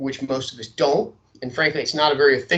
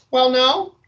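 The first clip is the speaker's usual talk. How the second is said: high, hesitant receptionist; gatekeeper voice; 8 semitones higher, 5 dB louder than usual. Acted. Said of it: "changing my voice a little bit".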